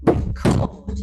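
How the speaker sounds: loud, split re-CORD; reCORD, as a verb; stress on second syllable. Shown as a demonstration. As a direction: drive the beat onto CORD in record. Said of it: "put it on the second syllable".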